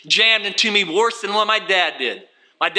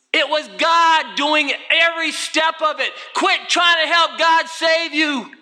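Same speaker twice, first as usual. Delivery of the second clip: fervent preaching; 7 semitones higher than usual. Acted. Preacher retelling the smoker begging God. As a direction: cry out the smoker's prayer.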